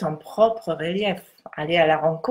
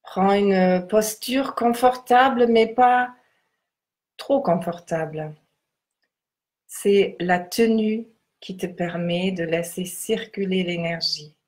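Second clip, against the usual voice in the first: guided meditation. Acted. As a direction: guide them gently into stillness.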